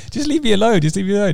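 mock girl telling the lad to leave her alone; imitating the girl. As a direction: brush him off in a high whine. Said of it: "the girl was like".

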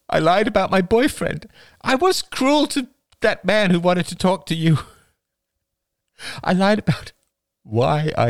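high, breaking, tearful outpouring; distraught confession. Acted. Rachel confesses her lies and attraction to Oliver, distraught. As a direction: blurt each admission out, voice climbing and catching.

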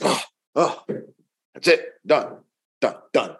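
a fed-up parent giving up; exasperated. Retold or imitated.

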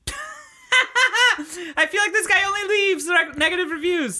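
high laughing squeal. Real, not acted. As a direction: laugh through the words.

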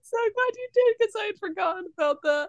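excited. Real, not acted. Delighted reaction.

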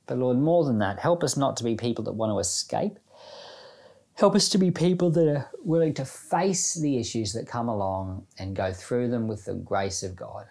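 ordinary talk

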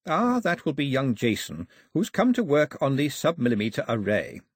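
ordinary delivery, nothing marked

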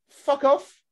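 high, loud fed-up snap; exasperated outburst. Real, not acted. Ranting at fake Italians, a high loud fed-up blurt.

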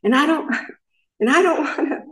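high, slow, resentful tirade; mild mock of the husband yelling; retold yelling. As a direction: throw his complaint back at her. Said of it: "just yelling".

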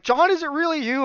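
high-pitched mock-emotional question; disbelieving mom. Mom coming round on the phone to her son, disbelief. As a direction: ask it high, hardly believing.

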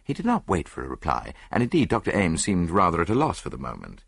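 ordinary delivery

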